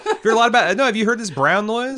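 high, laughing rush. Real, not acted. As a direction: burst out laughing mid-question. Laughing excited question.

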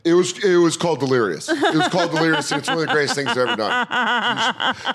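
high-pitched, worked-up know-it-all; mock indignant correction. Waiter butting into diners' comedy talk, exasperated correction. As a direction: correct them with rising exasperation.

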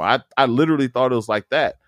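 normal talking voice

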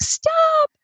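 high, sharp cry of stop. Retold or imitated. Mom at her limit cries to daughter and mother-in-law: high sharp stop. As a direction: cut everyone off with one high word. Snaps before grabbing cleaver.

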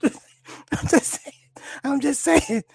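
high voice squeezed by laughter; laughing. Real, not acted. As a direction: get it out through leftover giggles. Laughing after own impression.

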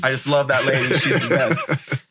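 chuckling through the words; fond laugh while talking. Real, not acted. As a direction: laugh as you praise her, slowing on the best. Laughing while praising cafe owner.

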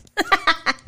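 a sudden high burst of laughter. Real, not acted. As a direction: laugh at his own foolishness. Laughs at his own mistake.

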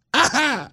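a quick high burst of laughter. Real, not acted. Teller laughing at the swearing parrot, high laugh. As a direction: laugh high at your own story.

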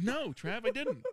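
mock reply to Travis.

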